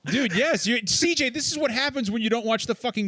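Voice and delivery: high-pitched, gleeful telling-off; excited scolding. Real, not acted. Excited scolding over missed announcement.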